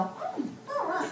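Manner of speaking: high, soft, drawn-out polite noises; slow, small-voiced pretend approval; feigned polite approval. Customer shown the mirror at the hairdresser's, feigning polite approval. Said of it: "that thing that you do in the hairdressers".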